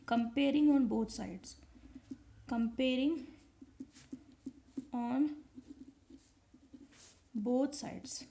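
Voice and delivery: spaced out as if writing along; slow dictation. Real, not acted. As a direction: dictate word by word with long pauses. Dictating while writing on board.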